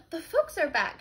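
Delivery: loud, high alarmed announcement; hurry, they're home; excited. Acted. Maid hears door, gasps.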